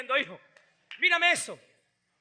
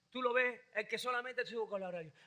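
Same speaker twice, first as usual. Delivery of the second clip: ordinary talk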